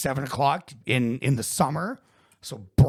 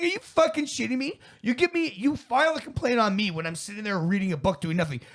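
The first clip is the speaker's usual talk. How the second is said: much higher, heated and indignant; furious retort; 9 semitones higher than usual. Retold or imitated. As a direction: tear into the security guard. Retold angry call to guard.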